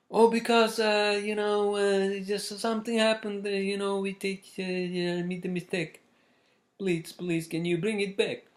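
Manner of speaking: high, pleading mock Spanish-accented lady; thick Spanish accent. Laundry lady begging for the bag back, imitated Spanish accent. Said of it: "in her thick Spanish accent".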